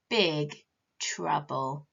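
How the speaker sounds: ordinary delivery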